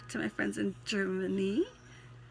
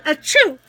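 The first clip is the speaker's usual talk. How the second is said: animated, twangy verse reading; twangy poem recital; 5 semitones higher, 20 dB louder than usual. Pretend. Poet recalls granddaddy's farm, twangy recital. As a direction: recite it heartily with a country twang. Staged sneeze interrupts poem.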